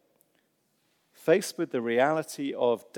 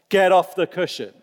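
exasperated demand; 5 semitones higher, 6 dB louder than usual. Acted. Disciples' meaning voiced.

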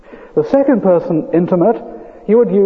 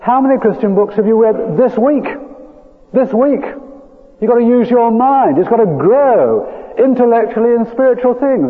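higher, forceful pulpit challenge; emphatic preaching; 6 semitones higher than usual. Real, not acted. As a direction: press the congregation with urgent conviction. Preaches, exhorting the congregation.

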